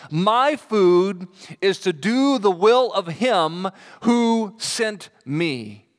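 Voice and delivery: drawn out, every word weighted; slow emphatic reading. Acted. Preacher reading the key verse to the church, slow and weighty. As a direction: read the verse slowly, leaning on each word.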